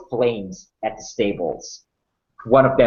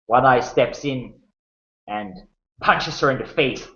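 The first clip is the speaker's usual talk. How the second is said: ordinary talk, nothing marked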